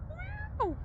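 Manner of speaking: high coaxing meow at a stray cat. Real, not acted. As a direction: coax the kitty with tiny high meows. Calling to the cat.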